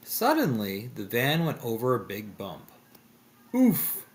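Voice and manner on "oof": high, loud "oof"; jolted cartoon grunt; Shaggy. A narrator's reading. Shaggy as the van hits a bump, jolted. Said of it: "said Shaggy".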